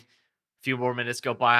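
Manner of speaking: normal talking voice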